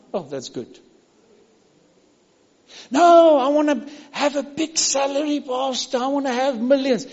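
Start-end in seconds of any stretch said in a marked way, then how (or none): 2.9-7.1 lightly voiced whiny churchgoer, a bit higher; mock greedy member. Preacher mocking a greedy congregant, whiny put-on voice. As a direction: mimic a greedy member demanding salary. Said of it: "mocking a greedy churchgoer".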